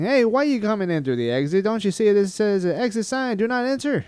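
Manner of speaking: high-pitched, indignant curmudgeon rant; Larry David. Retold or imitated. Curmudgeon pulling driver over at the exit, mock-indignant. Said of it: "It wasn't like Larry David pulled me over saying".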